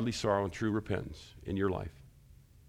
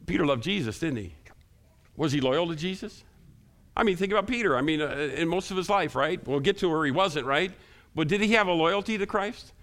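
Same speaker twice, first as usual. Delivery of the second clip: raised, higher-pitched preacher challenging the room; impassioned preaching; 8 semitones higher, 5 dB louder than usual. Real, not acted. Preacher questioning the congregation about Peter's loyalty, impassioned and raised. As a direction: press the point home with a loud, lifted voice.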